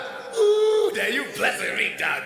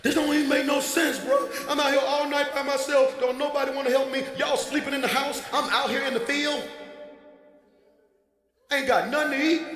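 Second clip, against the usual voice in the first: griping shepherd; 6 semitones higher than usual. Retold or imitated. Pastor voices lonely David griping in the field, mock whiny. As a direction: complain like you're stuck out there all night.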